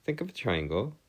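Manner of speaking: ordinary talk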